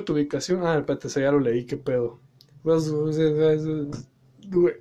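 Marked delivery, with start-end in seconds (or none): 2.6-4.7 burp through the words; words broken by a burp. Real, not acted. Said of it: "se me salió un eructo".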